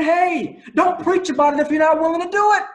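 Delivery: loud, high, rushing divine rebuke; God's voice. Pretend. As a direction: boom out God's warning, fast and urgent. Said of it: "But God is saying".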